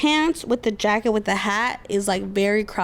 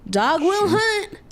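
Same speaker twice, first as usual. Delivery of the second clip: ad catchphrase; 9 semitones higher, much slower than usual. Acted. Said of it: "Monster commercial line".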